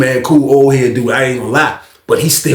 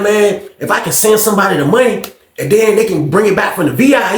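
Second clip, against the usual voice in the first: ordinary talk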